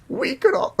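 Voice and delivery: put-on very high, strained old lady squeak; squeaky granny attempt. Trying a granny voice for the game, squeaky falsetto. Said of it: "I can't do a granny voice".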